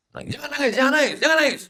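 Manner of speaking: put-on a little child scolding jangan nangis; child's voice. Child forbidding crying. (anak kecil melarang menangis, suara tinggi)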